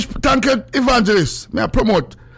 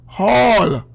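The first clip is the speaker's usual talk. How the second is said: drawn out; 3 semitones higher than usual. Real, not acted. Announcing a place name emphatically, drawn out.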